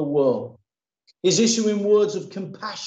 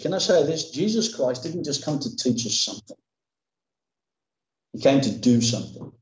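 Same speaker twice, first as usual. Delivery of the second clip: ordinary delivery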